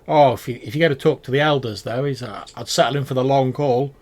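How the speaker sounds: amused merchant voice; knowing goblin warning. Acted. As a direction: tip off the traveller with a wink. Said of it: "He says".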